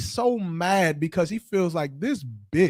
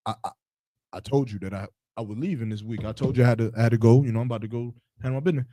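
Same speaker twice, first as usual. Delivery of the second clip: lower, softer stammering excuse; lower stammering man; 8 semitones lower, 4 dB quieter, much faster than usual. Retold or imitated. Man excusing himself to the other woman, lower and stammering. Man answering in acted quarrel.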